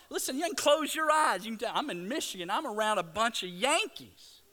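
loud, high, animated preacher; full-force preaching. Real, not acted. Preacher telling the congregation he can hear Yankees, full-force preaching. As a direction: drive the joke home at full voice.

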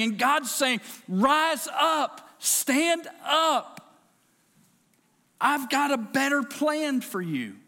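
a preacher at full cry urging the church to rise up; preaching at full force. Real, not acted. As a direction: drive it home to the congregation.

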